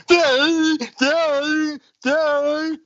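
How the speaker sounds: high, loud mocking "doo doo doo"; high hooting mimicry of a vacant child; hammed-up vacant noises; mock dim kid.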